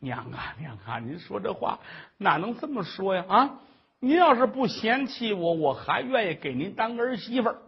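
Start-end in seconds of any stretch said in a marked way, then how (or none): none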